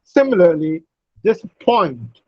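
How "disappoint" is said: "disappoint" landing on a strong falling POINT; primary stress on POINT. Shown as a demonstration. Teacher models "disappoint" for students, stressing POINT. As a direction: lighter DIS, main stress on POINT in "disappoint". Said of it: "Point has primary stress".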